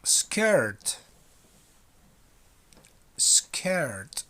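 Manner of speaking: Skirt with a crisp final T; Skirt, with the last T clearly sounded. Shown as a demonstration. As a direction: make the class hear the T in Skirt. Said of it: "Final T".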